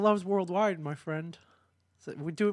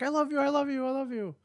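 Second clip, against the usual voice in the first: lovestruck voice; 10 semitones higher than usual. Retold or imitated. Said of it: "he's like".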